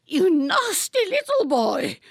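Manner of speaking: high, loud spiteful rebuke; mild fury. A narrator's reading. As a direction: spit it at him. Raging Lady Atkinson.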